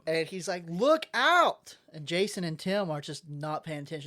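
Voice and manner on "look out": high, loud cry of alarm; urgent call-out; shouted warning. Acted. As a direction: yell to warn someone of danger. Acting out Batman's urgent warning.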